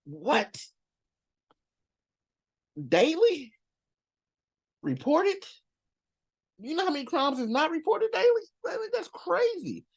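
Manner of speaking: squeaky-high incredulous outburst; hammed-up, high-pitched astonishment; mock disbelief. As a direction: voice shoots way up, playing up the shock. Feigns shock at crime figures.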